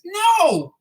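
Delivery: emphatic no. Real, not acted. Viewers told not to take work seriously, exclaimed emphatic no. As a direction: blurt it out, emphatic. Rhetorical answer in a rant.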